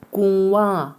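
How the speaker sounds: কুয়া said clearly as kuwa, with a nasal hum on the first syllable; careful pronunciation. Shown as a demonstration. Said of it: "শব্দের উচ্চারণ খেয়াল করেন".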